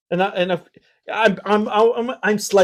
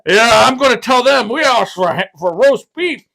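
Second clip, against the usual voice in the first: mock tough guy; 4 semitones higher, 5 dB louder than usual. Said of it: "we can hear him being like".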